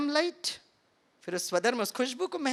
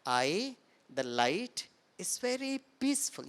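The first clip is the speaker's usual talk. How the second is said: peaceful affirmation; 4 dB quieter, much slower than usual. Shown as a demonstration. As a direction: let it settle softly. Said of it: "यही सिखाते हैं".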